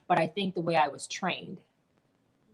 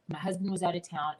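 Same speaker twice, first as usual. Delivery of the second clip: ordinary talk, nothing marked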